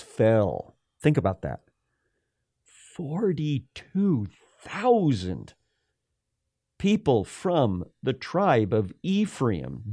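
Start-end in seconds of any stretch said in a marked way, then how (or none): 2.6-5.5 each word of "Forty-two thousand" drawn out slowly, higher; weighty emphasis. Real, not acted. Preacher marveling at the death toll, "Forty-two thousand" slowly drawn out.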